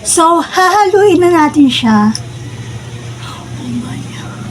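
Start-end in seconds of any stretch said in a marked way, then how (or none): 3.2-4.4 breathless whispered oh my God; awed whisper. Real, not acted. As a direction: whisper it slowly, stunned by the smell. Smelling the very spicy noodles. (pabulong na gulat)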